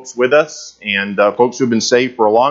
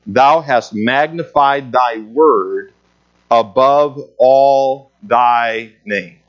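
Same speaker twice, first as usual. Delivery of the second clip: slow emphatic reading; much slower than usual. Shown as a demonstration. Teacher rereads the key verse.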